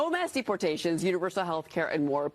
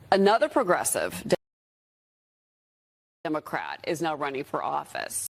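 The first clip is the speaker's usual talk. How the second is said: vocal fry; 5 semitones lower, 3 dB louder than usual. Real, not acted. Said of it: "Why does she talk like that".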